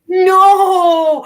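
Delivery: high, loud, stretched-out wail; mock-horrified scream, held long; drawn-out cry of dismay. Friend reacts to whispered movie spoiler, wailing in dismay.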